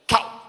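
"Cow" cut off abruptly; abrupt inflection. Shown as a demonstration. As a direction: say "Cow" very short and sudden. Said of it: "There's abrupt inflection".

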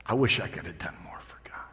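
fast hushed whisper of a crying father; whispered tearful quote. Retold or imitated. Son retelling his crying dad, whispered. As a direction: breathe it out like an old man in tears. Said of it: "he's just crying".

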